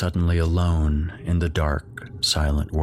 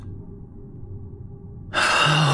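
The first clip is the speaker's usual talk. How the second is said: shaky exhale; 9 semitones higher than usual. A narrator's reading. Mother composing herself before parting, shaky sigh. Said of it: "shaky breath and then released it".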